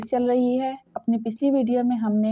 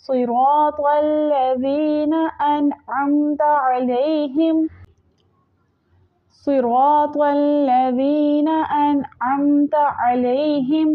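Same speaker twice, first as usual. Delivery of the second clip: slow melodic tajweed chant; Quranic recitation; 3 semitones higher, much slower than usual. Shown as a demonstration. Teacher demonstrating the recitation to students, slow melodic chant. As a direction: draw each word out in a measured chant.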